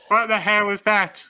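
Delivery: high, loud squeaky-voiced outburst; David Beckham. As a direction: put on a high-pitched impression, indignant. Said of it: "Good impression".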